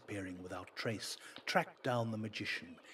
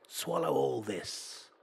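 loud, slow, higher order; command to the bag; 5 semitones higher, 7 dB louder, slower than usual. Acted. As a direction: banish all hell at once.

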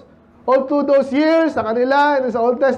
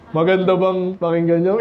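much lower, deep put-on preacher voice; imitating Pastor La Rosa; 5 semitones lower than usual. Pastor mimics a colleague's voice for the congregation, deep and lower. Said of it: "ginagaya ko".

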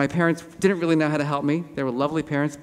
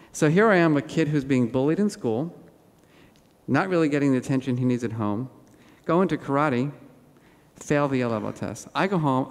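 ordinary talk, nothing marked

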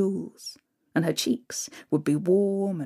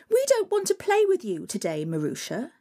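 mean stepsisters; 3 semitones higher than usual. Acted. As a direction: taunt her, snooty and cruel.

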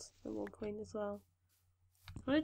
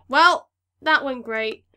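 sarcastic; 13 dB louder, slower than usual. Real, not acted. Failed plan, annoyed.

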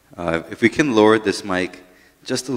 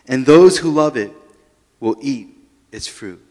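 solemn, emphatic Bible reading; louder, deliberate reading of Proverbs; scripture reading; 5 semitones higher, 6 dB louder than usual. Acted. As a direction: proclaim each verse to the congregation.

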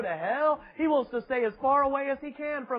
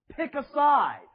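louder, higher demand; 6 semitones higher, 5 dB louder, slower than usual. Real, not acted. Preacher challenging the congregation, loud and higher. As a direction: challenge them to pick a side.

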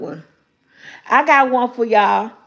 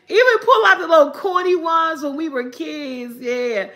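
ordinary talk

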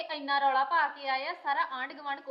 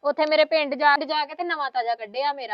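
angry complaint; 8 dB louder than usual. Acted. Angry complaint about wife's behaviour.

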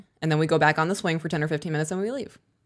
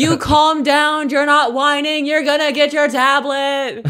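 high, loud, slow over-the-top praise; ecstatic praise; 10 semitones higher, 7 dB louder, slower than usual. Retold or imitated. Mom praising son for calming down, high excited gushing. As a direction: gush at him with every word stretched. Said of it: "And I lost my mind".

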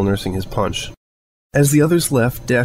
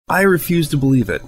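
raised, defiant voice; angry refusal; 6 semitones higher than usual. Acted. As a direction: refuse it hotly. Dash angrily refusing.

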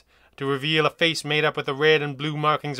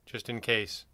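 plain reading voice

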